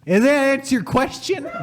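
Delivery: high, theatrical outburst; mock indignant shout. Finishing the tirade at the questioner, mock indignant shout. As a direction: shout it as a hammed-up finale.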